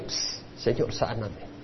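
hushed, breathy plea to the Lord; whispered prayer. Shown as a demonstration. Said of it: "Tú le puedes decir al Señor".

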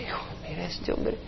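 scandalized whisper to a son; hushed, shocked aside about the stranger; gossiping whisper. Acted. Locals whisper about the pork-eating visitor, hushed and shocked.

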